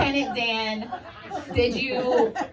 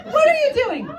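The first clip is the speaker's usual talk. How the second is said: mock startled question; 5 dB louder, faster than usual.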